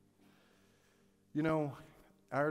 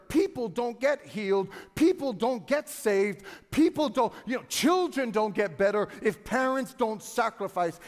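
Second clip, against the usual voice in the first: louder, driving sermon; preaching with force; 5 semitones higher, 9 dB louder than usual. Acted. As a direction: hammer each line home. Fervent sermon build.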